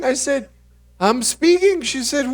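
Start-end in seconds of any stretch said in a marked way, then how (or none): 1.0-1.8 high, loud protesting son; hammed-up comeback in an argument with mom; mock exasperation. Acted quarrel with his mother.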